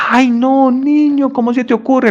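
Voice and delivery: high scandalized mother's cry; shocked mother. Acted. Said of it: "la mamá escuchaba". (madre escandalizada, voz muy aguda)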